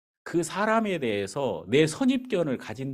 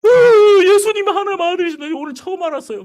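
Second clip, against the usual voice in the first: high, loud, fast tearful cry; imitated sobbing; 13 dB louder, much faster than usual. Bus driver cries to the pastor at conversion, loud sobbing. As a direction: burst out in tearful revelation. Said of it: "그러면서 막 울면서".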